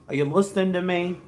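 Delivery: ordinary delivery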